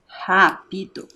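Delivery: "Rápido" said slowly and clearly; slow word. Shown as a demonstration. Teacher modelling "Rápido" slowly for the class. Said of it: "Separa lá comigo em sílabas".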